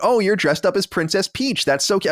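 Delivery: high, bright gushing over costumes; gushing sweet voice. Pretend. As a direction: coo over the kids' costumes.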